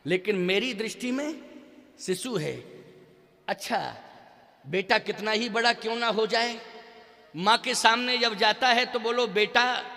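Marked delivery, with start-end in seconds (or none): none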